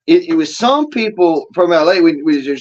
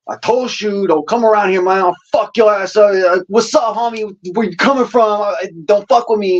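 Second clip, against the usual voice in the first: imitated East LA accent, higher pitch; 5 semitones higher than usual. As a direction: put on an East LA street voice. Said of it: "that's East L A".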